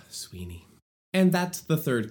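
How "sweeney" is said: Sweeney murmured much softer; soft aside; fond murmur. Real, not acted. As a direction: breathe the name Sweeney lovingly. Fond murmur after sigh.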